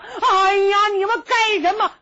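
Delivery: high, loud startled cry; a beaten prince crying out; alarmed cry. Acted. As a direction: yelp as they jump him. Prince attacked, cries out.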